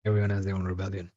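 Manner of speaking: lightly voiced character, soft and low-key; soft, quick imitation of the line; that character's voice. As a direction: quote it quickly in a hushed put-on voice. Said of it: "say it in that voice too".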